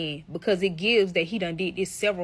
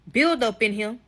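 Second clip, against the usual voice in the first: ordinary talk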